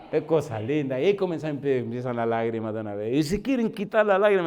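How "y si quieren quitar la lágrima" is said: voz aguda y fuerte de quien llora; feligrés lloroso. Retold or imitated. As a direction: imitando al que llora con vergüenza. Feligrés avergonzado que llora. (imitando al hombre avergonzado que llora, voz aguda y fuerte)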